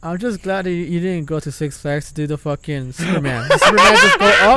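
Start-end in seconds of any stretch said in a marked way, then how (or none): 3.5-4.6 much higher, louder rising whoop; excited. Real, not acted. Friend describing the Superman ride, high loud whoop. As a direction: soar up with the ride. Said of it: "describing the ride".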